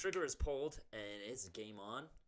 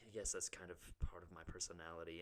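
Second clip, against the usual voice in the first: whispered self-deprecating confession; confessional whisper; 4 semitones lower, 7 dB quieter than usual. Real, not acted. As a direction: whisper it like a guilty secret. Confessing being a jerk.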